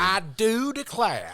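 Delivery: exaggerated Southern Belle drawl, slow and high; slow, high, overdone Southern Belle; Southern Belle accent. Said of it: "the worst, like, Southern Belle accent".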